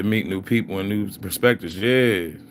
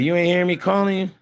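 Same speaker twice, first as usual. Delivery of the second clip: imitating the wife; 9 semitones higher than usual. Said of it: "And they like".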